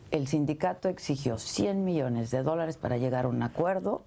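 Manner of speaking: ordinary talk, nothing marked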